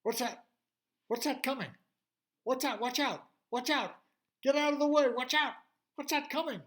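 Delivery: high, loud, urgent warning cries; alarmed shouting. Acted. Crowd calling out in alarm.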